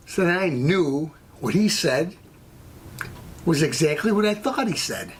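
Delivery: normal talking voice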